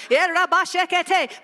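quick burst of mock tongues; playful mock praying in tongues. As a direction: rattle off fake tongues.

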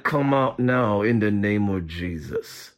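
very low, slow monotone through still lips; concealed, deep ventriloquist's command. Shown as a demonstration. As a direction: talk without moving your lips. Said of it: "I don't want my intercessor who's in training to read my lips".

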